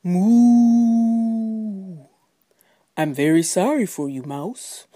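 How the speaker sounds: long drawn-out moos around a polite brush-off; moo, then apologise as an unbothered cow. Acted. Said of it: "And the cow said".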